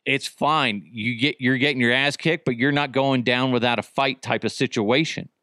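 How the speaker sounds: normal talking voice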